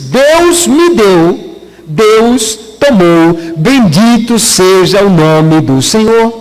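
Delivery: very slow, reverent recitation; solemn quoted blessing. Retold or imitated. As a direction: draw out each phrase like a solemn verse. Quotes Job blessing God after loss. (citação solene, bem lenta e pausada)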